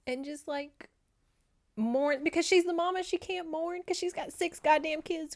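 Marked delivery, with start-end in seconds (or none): none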